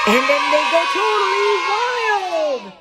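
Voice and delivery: high, loud and slow triumphant call; building excitement. Acted. Narrator announcing the animals' wild outburst, loud. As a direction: burst out wild with joy. Animals erupt to cheer Sue.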